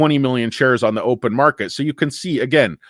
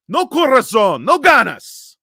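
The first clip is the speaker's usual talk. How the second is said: high, impassioned cry; dramatic Spanish scolding; 11 semitones higher, 5 dB louder, slower than usual. Acted. Scolding viewers for no heart, high melodrama. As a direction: cry it out high like a soap star.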